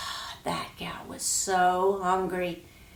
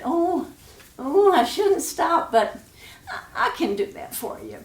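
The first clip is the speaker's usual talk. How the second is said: put-on hesitant high girl; 8 semitones higher, 3 dB louder than usual. Girl hesitating, then agreeing.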